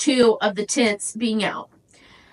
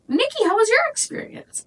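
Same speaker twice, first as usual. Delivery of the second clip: high curious; 11 semitones higher than usual. Retold or imitated. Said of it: "And I'm like".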